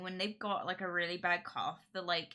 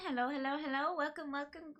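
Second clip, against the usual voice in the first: high singsong greeting; 8 semitones higher, faster than usual. Acted.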